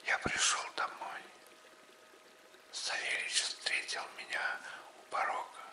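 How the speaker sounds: usual speaking voice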